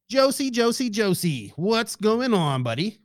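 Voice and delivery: a hint of a drawn-out radio host greeting; lightly voiced radio host calling out his co-host; radio personality voice. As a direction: greet him like a hammed-up DJ. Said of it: "American radio personality now?".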